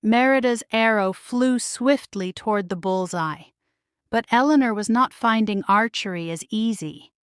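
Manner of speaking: ordinary delivery, nothing marked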